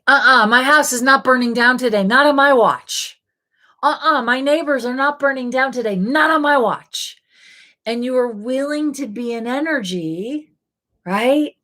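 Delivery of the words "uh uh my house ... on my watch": firm, higher-pitched sassy refusal; acted defiance. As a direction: put your foot down, as if nobody gets past you. Voicing a defiant energy as an example.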